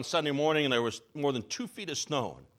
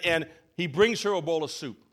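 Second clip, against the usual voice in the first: normal talking voice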